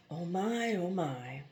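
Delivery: barely voiced, soft and faint; a dying woman's last breath of words; faint breathless murmur. A narrator's reading. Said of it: "She could only move her lips noiselessly".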